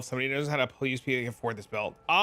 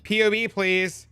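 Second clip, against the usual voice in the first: slow, higher, drawn-out mock pleading; begging viewers; 6 semitones higher, 4 dB louder, slower than usual. Streamer imitating viewers begging for his build, slow mock pleading. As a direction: drone the request like a pestering viewer.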